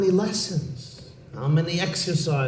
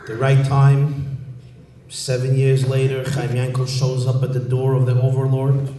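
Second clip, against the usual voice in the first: usual speaking voice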